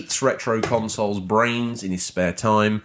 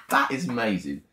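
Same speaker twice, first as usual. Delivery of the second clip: ordinary talk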